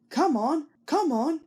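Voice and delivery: lightly puffed urging; puffing. A narrator's reading. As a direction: puff it out like a straining engine. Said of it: "he puffed".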